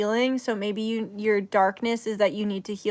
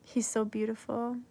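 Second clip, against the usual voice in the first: ordinary talk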